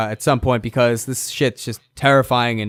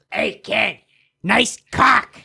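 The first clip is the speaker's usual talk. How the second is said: higher-pitched creature calling out; little creature; 8 semitones higher, much slower than usual. Acted. Small creature heckles the kid, higher creature voice. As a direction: pipe up like a cheeky little creature. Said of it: "the little creature turned around".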